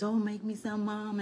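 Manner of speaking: a hint of a wheedling son; retold son's plea. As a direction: lightly voice a son coaxing his mother. Retold son asking mother for food.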